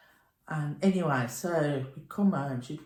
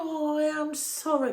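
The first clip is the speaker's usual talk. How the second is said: higher, imitated sympathetic nan; nan's sympathy; 12 semitones higher, 4 dB louder than usual. Nan consoling Anne over the lost budgie, lightly voiced higher. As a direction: lightly play nan saying sorry. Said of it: "she went".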